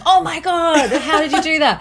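high-pitched mock disbelief; shocked reaction. Host voicing appalled listeners hearing about placenta capsules, mock shocked. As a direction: imitate an aghast listener, pitch jumping right up.

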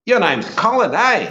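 put-on a kid amazed to spot someone famous; kid's voice. A boy in the river spotting the Play School host, excited. Local kid recognises him swimming.

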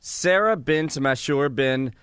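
slow, deliberate name recital; careful full recital. Real, not acted. Co-host proving she can say it now, slow and careful. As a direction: recite the long name slowly, part by part.

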